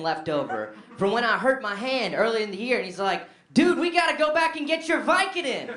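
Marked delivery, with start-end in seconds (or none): 3.5-5.7 high, loud urgent pitch; buzzing drunk enthusiasm; excited plea. Retold or imitated. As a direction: sell the idea eagerly. Roommate's excited idea.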